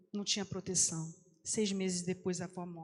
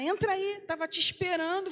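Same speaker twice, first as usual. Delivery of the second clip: higher, louder welcoming call; cheerful call; 9 semitones higher, 5 dB louder than usual. Retold or imitated. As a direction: call her in gladly. Vizinha chama a menina. (chamado alegre, mais agudo e alto)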